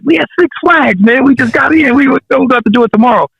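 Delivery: very high, pleading imitation of himself; retold protest. Son at Six Flags telling dad it must wait, high retold protest.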